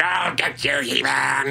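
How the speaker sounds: a hint of gravelly villain shout; lightly voiced gravelly cartoon baddie; gravelly Skeletor voice. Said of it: "He does that gravelly voice thing".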